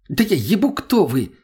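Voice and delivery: high-pitched defensive retort; snapping back. A narrator's reading. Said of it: "вдруг огрызнулся он".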